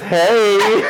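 high, drawn-out hello; playful greeting. Retold or imitated. Male regular greeting his waitress, high playful call. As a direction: imitate a customer calling out playfully.

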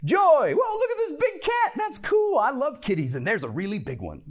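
put-on high, loud kid squeal of delight, easing off by the end; hammed-up wide-eyed wonder; joyful child. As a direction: marvel out loud like a thrilled kid. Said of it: "the child has what emotional reaction".

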